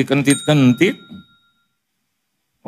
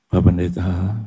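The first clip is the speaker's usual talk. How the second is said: friend's troubled voice; 10 semitones lower than usual. Retold or imitated. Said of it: "Suaranya saya bisa ikutin".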